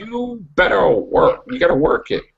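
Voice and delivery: sassy urging. Pretend. Urging Jacob, slow and high.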